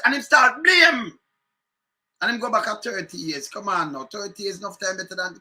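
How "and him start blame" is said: slow, loud shouted indignation; angry shout. Real, not acted. Angry host on air, ranting about the minister blaming the past, shouting. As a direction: hammer the word blame out slowly and loudly.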